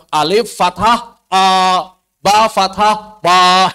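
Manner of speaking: "Alif fathah A, ba fathah ba" slow and loud, vowels stretched; over enunciating. Shown as a demonstration. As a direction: spell out "ba fathah ba" slowly. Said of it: "Itu enggak boleh".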